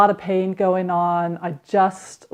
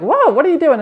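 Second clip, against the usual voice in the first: startled husband; 8 semitones higher, 4 dB louder, faster than usual. Retold or imitated. Husband startled by sudden dancing.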